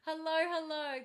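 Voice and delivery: ordinary delivery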